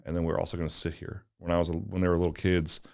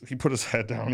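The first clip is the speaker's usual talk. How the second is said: ordinary talk, nothing marked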